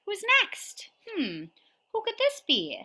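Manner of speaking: high storytime voice; reading to a toddler. Acted. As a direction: ask it like to a toddler. Reading to little kids.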